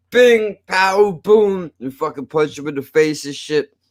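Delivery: ordinary talk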